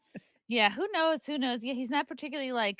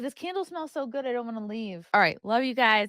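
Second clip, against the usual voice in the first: normal talking voice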